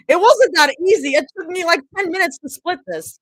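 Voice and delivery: high, loud excited aside. Real, not acted. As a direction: burst out with it excitedly. Excited aside about apple.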